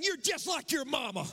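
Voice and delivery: high, shouted insult at a spouse; hammed-up furious yell; mock angry shout. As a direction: hurl it like a fight at home. Insulting spouse in a home argument.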